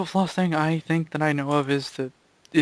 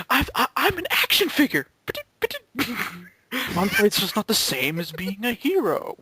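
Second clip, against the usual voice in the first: toy hero voice; 8 semitones higher than usual. Retold or imitated. Quoting a toy cartoon scene.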